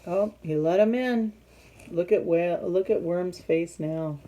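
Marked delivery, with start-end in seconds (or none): none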